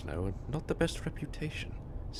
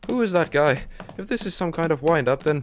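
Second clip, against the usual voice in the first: louder and breathless after the chase; shaken, loud; 8 dB louder than usual. Acted. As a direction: demand answers, still rattled.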